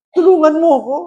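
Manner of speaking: high acted appeal; pleading father. (pagsusumamo ng tatay, mataas ang boses)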